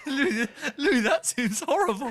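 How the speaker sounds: high wheezing laughs through words; helpless laughter. Real, not acted. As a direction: laugh so hard you can barely talk.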